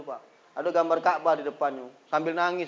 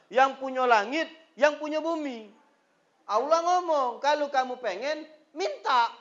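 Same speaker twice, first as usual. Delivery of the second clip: fervent preaching; 9 semitones higher than usual. Acted. Preaching at full force.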